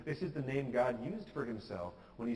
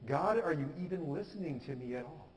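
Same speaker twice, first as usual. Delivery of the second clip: raised, insistent plea, easing toward the end; acted yelling; 4 semitones higher than usual. Believer pounding on God's door, mildly yelled demand. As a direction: press God urgently with each question. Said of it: "yell at your dad".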